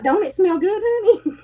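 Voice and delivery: high-pitched mock sweet-talk; playful wifely coaxing. Wife retelling her bedtime talk with husband, playful high coaxing. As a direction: coax him playfully, voice lifted high.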